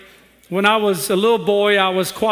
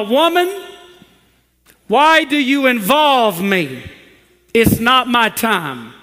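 voicing Jesus; 4 semitones higher, 4 dB louder than usual. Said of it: "Jesus said to his mama".

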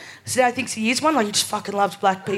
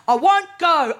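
higher, louder flat refusal; mock stubborn old lady shouting no; defiant refusal; 6 semitones higher, 5 dB louder, slower than usual. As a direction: dig in your heels and refuse. Old auntie digs in her heels.

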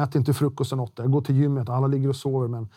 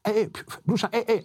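imitated inmate calls; 8 semitones higher, faster than usual. Inmates calling to each other on the roof walk, imitated calls. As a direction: mimic someone calling out from another cell. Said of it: "det enda man hör där".

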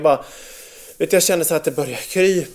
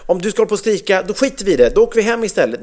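higher, fast scolding threat; retold scolding; 6 semitones higher, much faster than usual. Dad retelling how he threatened his screaming son, mock sharp and fast. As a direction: snap back at the child, mildly mock angry.

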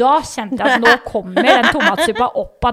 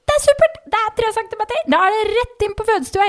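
much higher, bright mock midwife cheer; chirpy midwife; 10 semitones higher than usual. Midwife cheerfully praising the mother, high mock voice. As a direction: imitate the upbeat midwife, pitched way up.

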